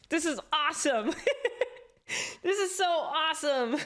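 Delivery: giddy high laughter while talking; laughing. Real, not acted. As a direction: laugh through the words with joy. Laughing with joy on stream.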